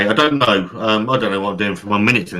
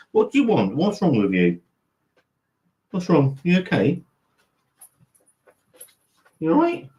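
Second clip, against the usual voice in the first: talking to the dog; 7 semitones higher than usual. Real, not acted. Fussing over the dog.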